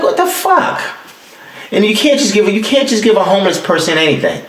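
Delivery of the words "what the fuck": incredulous squeak. Retold or imitated. Reacting to the Whopper idea.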